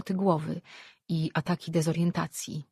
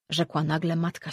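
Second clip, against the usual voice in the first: usual speaking voice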